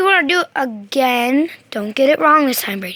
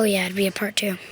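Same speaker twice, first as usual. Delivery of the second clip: quick aside whisper; 6 semitones lower, 6 dB quieter than usual. Real, not acted. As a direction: whisper it to yourself.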